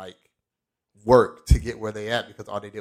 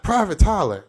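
drill sergeant call; 8 semitones higher, 4 dB louder than usual. Retold or imitated. Drill sergeant calling recruit out.

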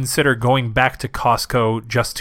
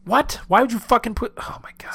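exasperated whisper; 8 semitones higher than usual. Real, not acted. Exasperated at booby-trapped books.

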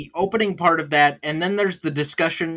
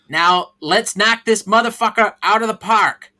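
put-on rousing, forceful Boston-accented pep talk. Boss firing up brokers to start dialing, Boston accent. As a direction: drive the sales floor on in a Departed-style Boston accent. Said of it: "you do the Departed Boston accent for this speech".

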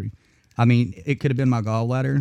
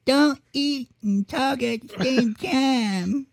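high, slow scolding mom; nagging mother voice; 13 semitones higher, much slower than usual. Retold or imitated. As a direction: nag like a fussy mother. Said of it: "She'd be yelling in my ear".

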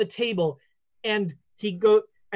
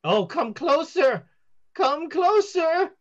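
higher, slow drawn-out invitation; beckoning seer; 5 semitones higher, slower than usual. Acted. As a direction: lure them in theatrically. Fortune teller beckons.

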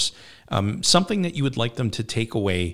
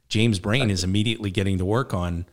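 ordinary talk

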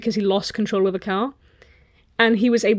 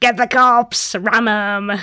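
put-on voice, louder orders; talking cat; 5 dB louder, much slower than usual. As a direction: urge violence as the evil cat. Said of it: "And they're like".

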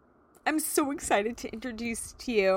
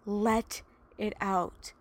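gentle release; much slower than usual. Real, not acted. Urges listeners to release.

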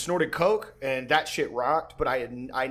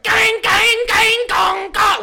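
high, loud nonsense chant; mocking his own drug-fuelled song; 11 dB louder, much slower than usual. Mimicking his own manic chant, loud and high. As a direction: blare it out like a manic hype loop. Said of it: "it's just fucking totally insanity".

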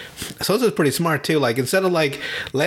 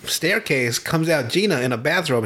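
ordinary talk

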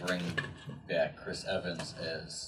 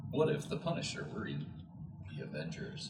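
lower, softer mock episode title; understated title reading; 3 semitones lower, 5 dB quieter than usual. Friend reworking the joke episode title, understated mock reading. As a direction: drop to a hushed, deadpan title. Same title tried again, subdued.

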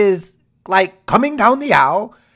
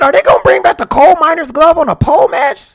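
excited; 9 semitones higher, 6 dB louder than usual. Retold or imitated. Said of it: "I said".

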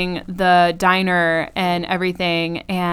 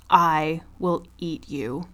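low, slow imitated threat; acted-out menace, low and unhurried; quiet menace; 4 semitones lower, 5 dB quieter, slower than usual. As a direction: threaten softly, word by word. Acting out Sarah threatening heckler.

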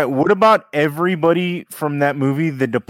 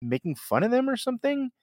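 incredulous question; 8 semitones higher, 4 dB quieter than usual. Retold or imitated. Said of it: "And I'm like".

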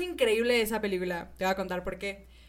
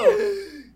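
high, loud thrilled gasp; excited gasp; 9 semitones higher, 8 dB louder than usual. Real, not acted. Hearing her friend still has the game, loud thrilled gasp. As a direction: gasp with delight.

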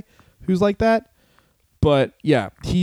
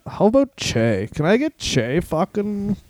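mock pothead request, dragged out; stoner dude voice; 4 semitones lower than usual. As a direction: play a stoned wrestler ordering trunks. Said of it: "all his trunks were like".

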